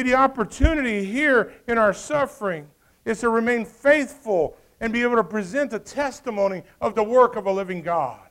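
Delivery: preaching at full force. Acted. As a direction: call the church to faithfulness.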